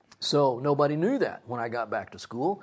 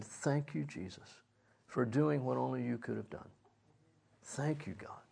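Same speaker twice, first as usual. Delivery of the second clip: very soft, reverent thanks; hushed thankful prayer; 3 semitones lower, 9 dB quieter than usual. Retold or imitated. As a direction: murmur gratitude to God, almost whispering. Retold prayer of thanks after miracle.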